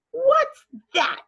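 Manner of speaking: put-on high, loud boy's demand. Brother Avery demanding to see the pig, high loud voice. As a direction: bark it out like a nosy ten-year-old. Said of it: "he demanded".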